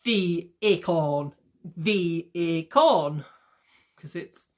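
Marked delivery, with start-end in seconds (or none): 0.1-3.2 "the acorn" slowly, syllable by syllable; alternate-syllable stress. Shown as a demonstration. Teacher sounding out every other syllable, slow stress. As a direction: draw out each beat of "acorn". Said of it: "every other syllable".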